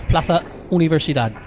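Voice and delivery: Spanish accent, a lisped z in Plaza; Spanish pronunciation. Shown as a demonstration. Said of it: "as they say in Spanish".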